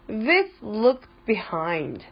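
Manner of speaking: usual speaking voice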